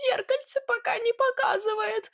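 very high squeaky whimper; squeaky, cowed Sidor; whining. Acted. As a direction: whine the excuse in a piping voice. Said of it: "проскулил тот".

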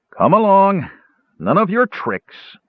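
high, loud command, slowing after; rough order. A narrator's reading. Guard shoving Tass in the back, rough order.

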